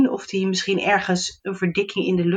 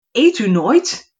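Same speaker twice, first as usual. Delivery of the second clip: curious gnome; 4 semitones higher, 6 dB louder, slower than usual. Acted. Gnome asks fairy.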